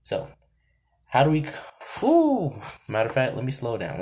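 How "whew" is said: high, loud "whew"; sudden high whoop of a whew. Real, not acted. Catches self, high exhaled whew.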